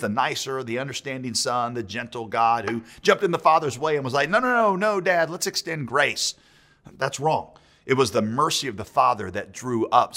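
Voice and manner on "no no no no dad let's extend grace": higher-pitched mock plea to Dad; pleading son. Preacher voices Jesus stepping in before the Father, mock pleading. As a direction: imitate a son hurriedly interceding, pitched up.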